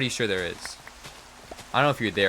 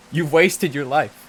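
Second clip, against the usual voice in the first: mock taunt; 4 semitones higher, 6 dB louder, slower than usual. Repeats taunt slower, louder.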